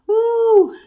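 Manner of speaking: high, loud whoop; excited woo. Real, not acted. Hearing a viewer has three degrees, a loud high whoop. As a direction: let out a shivery cheer.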